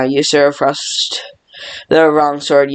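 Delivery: normal talking voice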